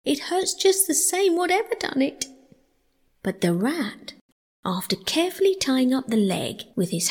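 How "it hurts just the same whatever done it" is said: high-pitched complaining mole; mole in pain. A narrator's reading. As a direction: whine about how it hurts. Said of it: "forgetting his grammar in his pain".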